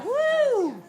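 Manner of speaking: high, long "woo"; excited whoop. Real, not acted. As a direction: let out a delighted high whoop. Whoops at the room's energy.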